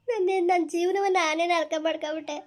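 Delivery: sobbing despair; crying in regret. Acted. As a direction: wail it as self-reproach. Young woman weeps over ruined life.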